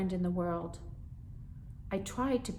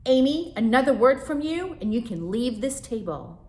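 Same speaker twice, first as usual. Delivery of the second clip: higher, loud threat; stern mother; 6 semitones higher, 9 dB louder than usual. Acted. Mother warning her daughter, high and loud. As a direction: threaten her with leaving the table. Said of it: "commanded my mom".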